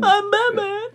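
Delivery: high, strained comic Batman voice; hammed-up, high-pitched caped crusader; Batman impression. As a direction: squeeze it out as a silly mock hero.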